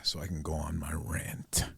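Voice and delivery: murmured under the breath, almost no voice; whispered aside. Real, not acted. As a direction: breathe it out like a secret before the rant. Aside while sipping energy drink.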